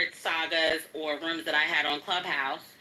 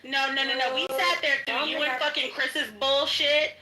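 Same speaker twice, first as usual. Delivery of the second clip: heated, raised voice; 6 semitones higher than usual. Real, not acted. Heated argument.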